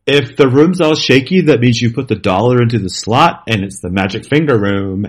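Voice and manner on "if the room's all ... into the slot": usual speaking voice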